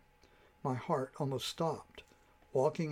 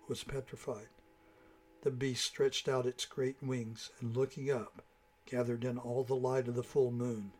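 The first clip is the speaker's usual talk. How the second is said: plain reading voice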